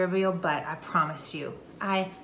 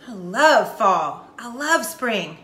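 imagined delight; 6 dB louder, much slower than usual. Acted. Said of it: "wonderful to walk outside and breathe and be like".

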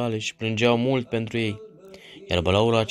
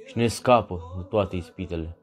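closing prayer. Real, not acted. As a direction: pray it gently, drawing out the words. Closing prayer after the reading. (rugăciune rostită rar și smerit)

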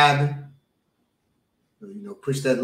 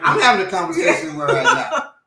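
laughing; 5 semitones higher, 4 dB louder than usual. Real, not acted. Laughing reply to teasing.

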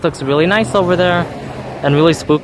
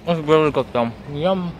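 talking while chewing; words round a bite of food; mouth full. Real, not acted. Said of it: "Still eating, guys, chewing".

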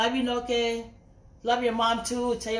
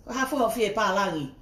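ordinary talk

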